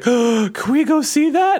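put-on high, slow, eager kid plea; excited little girl. Dad imitating his daughter begging to see the sequel, high and eager. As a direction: beg like a thrilled child.

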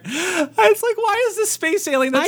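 high, laughing bafflement; giggly disbelief. Real, not acted. Laughing over the silly alien, giggly. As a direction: squeak it out, still tickled. Laughing about favourite part.

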